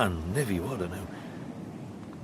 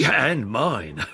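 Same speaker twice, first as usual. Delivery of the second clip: usual speaking voice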